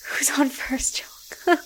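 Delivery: soft joking whisper. Real, not acted. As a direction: hush it like an aside. Joke aside about comedy duo.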